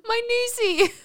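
high, far voice saying "My noosey"; mocking imitation. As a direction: mimic him in a silly high voice. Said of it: "Don't say it like that".

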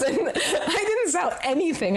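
high, laughing through the words. Real, not acted. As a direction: laugh at your own failure. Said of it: "repeated plainly before".

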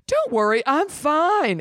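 injured Ellie sassily waving off concern; sassy. Acted. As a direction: toss it off with sass. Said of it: "make Ellie a little bit more sassy".

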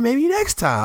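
teasing refusal. Retold or imitated.

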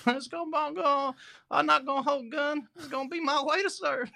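exaggerated Southern US accent, laughing edge; mock Southern accent. Mimicking actor's Southern accent.

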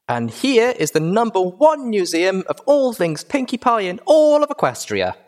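high, loud filly showwoman; bubbly, high-pitched tour pitch; bouncy excitement. A narrator's reading. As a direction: present it grandly like a thrilled child. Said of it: "practically bouncing".

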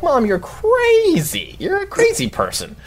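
very high, drawn-out retort; re-enacted exasperation, stretched and squeaky; exasperated protest. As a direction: whine it at mom, voice way up. Retold argument with mom.

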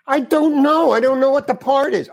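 high, loud frustrated burst; exasperated. Retold or imitated. Actor to the director, loud exasperated outburst. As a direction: blurt it out at wit's end.

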